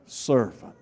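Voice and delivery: "servant" spoken softly and set apart; a quiet, weighty "servant"; stressing servant. Shown as a demonstration. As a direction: drop the voice and land on "servant". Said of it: "emphasis on that word".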